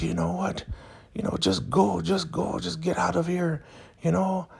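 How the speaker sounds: high-pitched imitated dismissal; mock upset woman. Elder Mother upset at Zane, high mock dismissal. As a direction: send her away in a high, put-on voice. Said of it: "she was definitely upset at Zane".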